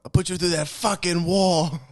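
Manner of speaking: loud, high, yelled Boston threat; Bill Burr. Acted. Host attempting a Boston comedian, yelled impression. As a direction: bellow it like an angry Boston comic. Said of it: "what's Bill Burr sound like?".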